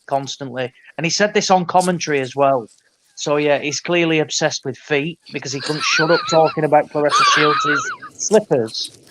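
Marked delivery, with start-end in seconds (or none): 5.8-9.0 words breaking into laughter; giggling out the punchline; laughing. Real, not acted. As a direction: laugh through the last words. Laughing at a mate's foot fetish.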